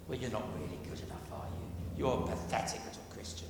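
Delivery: low, quick, half-whispered taunt; sneering evil voice. Acted. Preacher voicing the evil one's taunt, low sneer. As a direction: hiss it like the tempter. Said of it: "that little cancerous voice saying".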